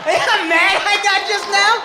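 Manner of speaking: high, loud yell of disbelief; loud mock outrage at the crowd; outraged shout. Real, not acted. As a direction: shout it at him, incredulous. Outraged shout at audience member.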